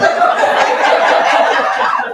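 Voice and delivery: high, loud peal of laughter. Real, not acted. Speaker cracking up over husband's turnaround, loud laughing. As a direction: laugh helplessly and loudly. Laughing at her own story.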